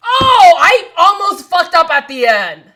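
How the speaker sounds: high, loud yell easing downward; excited outburst. Real, not acted. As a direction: cry out after a near miss. Relieved outburst after typing test.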